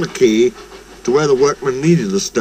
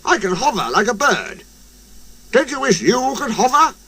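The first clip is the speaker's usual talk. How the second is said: boastful helicopter voice; 8 semitones higher than usual. A narrator's reading. Harold boasting.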